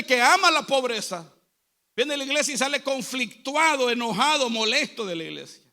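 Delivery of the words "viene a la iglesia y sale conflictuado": ordinary talk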